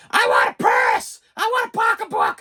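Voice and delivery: shouted mock tantrum of a small child; loud wailing kid demanding toys; child's screaming tantrum. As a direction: yell like a toddler throwing a fit. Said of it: "somebody starts screaming".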